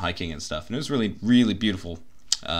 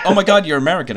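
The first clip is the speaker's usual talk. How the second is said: excited; 8 semitones higher, 6 dB louder, faster than usual. Retold or imitated. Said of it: "they're like".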